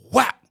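sudden high-pitched "whap"; imitated slap sound. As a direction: act out the smack with your voice.

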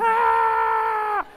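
long, high, loud scream; hammed-up scream of waking terror; imitated nightmare scream. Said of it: "I thought I was having a nightmare".

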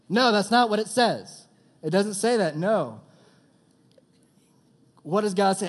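loud, high no, that's not it; emphatic denial. Real, not acted. Preacher refuting his own joke, loud and high. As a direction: cut the joke off hard. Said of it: "correcting the joke line".

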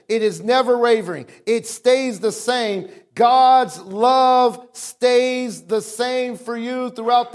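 rhythmic pulpit build, slowing onto key words; impassioned preaching. Real, not acted. Preacher assures congregation of constant love, impassioned preaching. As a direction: drive each repeated phrase home to the congregation.

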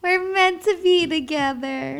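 high, loud, sugary singsong; playful gushing. Pretend. Wife finishing husband's sentimental thought, playfully gushing. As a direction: gush it in a high, lilting voice.

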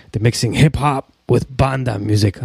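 ordinary talk

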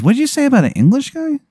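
high, quick startled question; incredulous wizard. Acted. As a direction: ask in hammed-up disbelief. Wizard reacts to news of rival.

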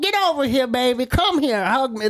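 high, calling older lady's impression; thrift store lady. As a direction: call a favorite customer over in an older lady's high voice. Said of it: "I'll do her voice at the thrift store".

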